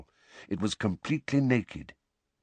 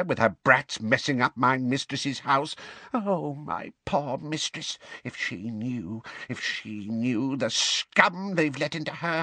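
hoarse muttering elf; 8 semitones higher than usual. A narrator's reading. House elf muttering insults about the guests, grumbling elf voice. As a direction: grumble to yourself like a bitter old elf. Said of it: "muttering under its breath in a hoarse, deep voice like a bullfrog's".

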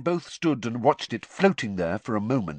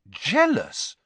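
louder, disbelieving echo; incredulous; 10 semitones higher, 6 dB louder than usual. A narrator's reading. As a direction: throw the word back in disbelief. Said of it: "Harry said incredulously".

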